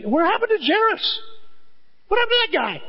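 high, far-off calling voice of Jesus; searching voice. Acted. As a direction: call out over the crowd, looking around.

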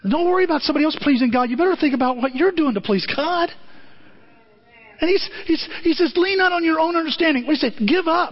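high, fast, driving preaching. Real, not acted. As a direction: urge the congregation to please God, fervent. Preaching at full force.

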